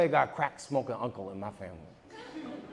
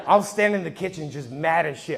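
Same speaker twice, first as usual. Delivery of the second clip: ordinary delivery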